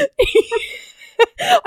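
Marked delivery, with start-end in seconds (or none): 0.0-0.1 higher-pitched, talking through giggles; can barely get the words out for laughing. Real, not acted. Teasing her co-host about the animated accent, laughing.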